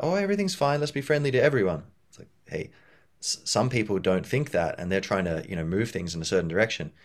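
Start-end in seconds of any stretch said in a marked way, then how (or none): 0.0-1.8 high-pitched naive cheer; wishful thinking. Acted. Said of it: "you can't just be wishful thinking".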